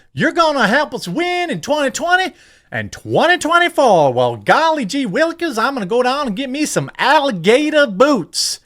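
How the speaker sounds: high, hammed-up Southern caricature accent; Aunt Jemima. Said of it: "Aunt Jemima says".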